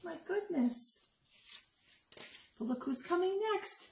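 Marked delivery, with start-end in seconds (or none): none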